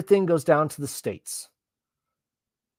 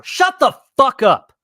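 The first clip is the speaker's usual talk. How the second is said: slow, loud, scornful shout; fed-up yell; angry; 5 semitones higher, 9 dB louder, slower than usual. Real, not acted. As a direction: bark it at the screen. Yelling at protesters on screen.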